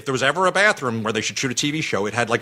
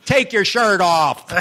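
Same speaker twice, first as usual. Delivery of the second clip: mock coaxing family; 7 semitones higher, 4 dB louder, slower than usual. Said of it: "his daughter and his wife are going".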